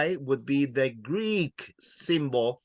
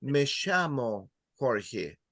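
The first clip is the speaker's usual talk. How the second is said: slow Argentine "Me llamo Jorge", ll as "sh"; "llamo" said "shamo", River Plate style; Argentine accent; 2 semitones lower, 4 dB quieter, slower than usual. Shown as a demonstration. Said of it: "in Argentina and Uruguay, they will say".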